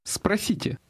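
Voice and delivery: usual speaking voice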